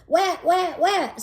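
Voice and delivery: put-on little "Wet, wet, wet" like a kid who can't say r; toddler lisp. Little brother chiming in, r as w. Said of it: "He doesn't say his R's right yet".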